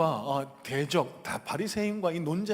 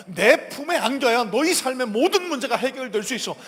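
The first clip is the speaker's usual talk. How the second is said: loud, high-pitched proclamation; forceful sermon climax voicing Christ; impassioned preaching; 8 semitones higher, 11 dB louder than usual. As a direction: declare it with full authority to the crowd. Preaches as Jesus at full force.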